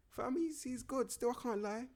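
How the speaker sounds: can't believe how good he is; excited. Real, not acted. Admitting a player is good, high excited voice.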